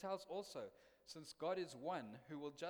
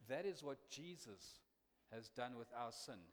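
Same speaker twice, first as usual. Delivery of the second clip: normal talking voice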